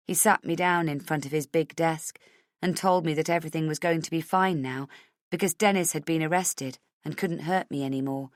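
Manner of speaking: usual speaking voice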